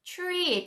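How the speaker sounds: 트리 slow, t toward ch; slow 트리 with ch edge; slow ch-like tree. Shown as a demonstration. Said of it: "티알은 트리". (트리를 천천히)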